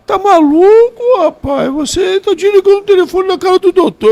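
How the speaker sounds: high, loud mock scolding voice; imitating Jair. Jair scolding Nelson over the phone, high mock voice. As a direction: squeal it out like an exasperated buddy. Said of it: "aí o Jair retornou a ligação e falou".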